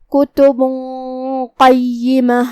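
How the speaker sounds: کتب قیمہ with a heavy nasal hum before the qaf; heavy hidden nasal. Shown as a demonstration. Teacher models ikhfa with tafkheem for student. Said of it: "ہم یہ حکم کو ایسے ادا کریں گے".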